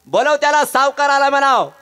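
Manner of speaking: loud, high, commanding lender; arrogant moneylender. Acted. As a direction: order the boy around with swagger. Moneylender orders boy. (उद्धट सावकाराचा मोठा हुकूम)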